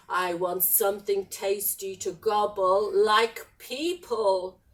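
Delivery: low-pitched, menacing dragon voice; hungry dragon. Acted. Dragon wants people to eat, menacing.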